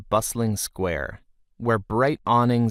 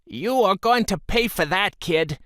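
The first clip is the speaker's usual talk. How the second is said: higher, angry shopkeeper yell; shrill-voiced shopkeeper woman, lightly shouting; hollering shopkeeper woman; 10 semitones higher than usual. A narrator's reading. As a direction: holler at the boy who broke her vase. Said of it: "she hollered at him".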